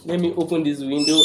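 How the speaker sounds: usual speaking voice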